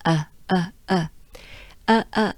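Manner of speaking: short, separate "uh" vowels; slight "uh" ending. Shown as a demonstration. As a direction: tail off into a slight "uh". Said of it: "a little bit in a, uh, in a slight".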